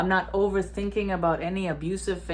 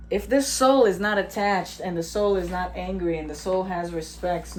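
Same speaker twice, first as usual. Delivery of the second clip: ordinary talk, nothing marked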